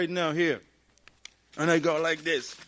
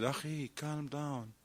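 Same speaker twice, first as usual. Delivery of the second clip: calming a brother; 3 semitones lower, 8 dB quieter than usual. Retold or imitated. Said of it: "We said".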